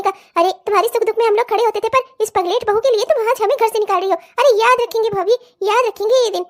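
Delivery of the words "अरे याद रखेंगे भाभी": ordinary delivery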